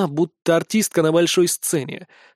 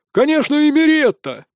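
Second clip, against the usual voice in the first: high, eager heckle; shout from the crowd; 9 semitones higher, 4 dB louder than usual. A narrator's reading. Spectator voting for a dancer, shouting out. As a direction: call out your favourite, pitched well up. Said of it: "пошли выкрики".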